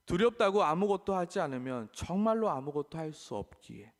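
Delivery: ordinary delivery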